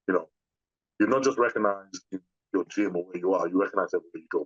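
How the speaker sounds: ordinary talk, nothing marked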